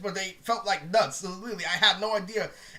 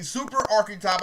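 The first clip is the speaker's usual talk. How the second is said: ordinary talk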